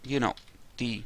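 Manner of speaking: ordinary delivery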